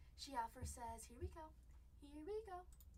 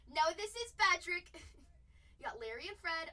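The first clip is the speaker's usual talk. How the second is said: ordinary talk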